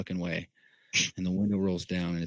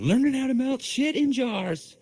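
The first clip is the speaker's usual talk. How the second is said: high mock voice. Joking high voice.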